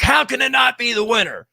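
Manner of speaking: high-pitched imitation of a friend's outrage; mock losing it. Host imitating a friend whose favorite show lost, mock outraged. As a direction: put on a high voice, aghast at the loss. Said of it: "lost his stuff".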